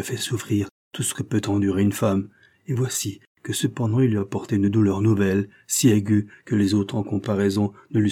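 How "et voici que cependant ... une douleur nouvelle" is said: ordinary delivery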